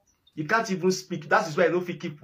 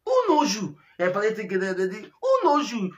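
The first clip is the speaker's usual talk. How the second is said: repeated jeering who knows you; scornful jeer who knows you; mocking taunt, higher and rising; 5 semitones higher than usual. Real, not acted. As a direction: taunt him, each time higher.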